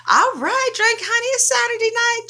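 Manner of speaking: high-pitched, playful party voice; high party cheer. Real, not acted. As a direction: hype up your drinking buddy.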